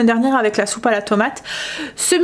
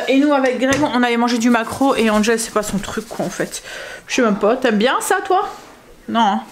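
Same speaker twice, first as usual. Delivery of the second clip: ordinary talk, nothing marked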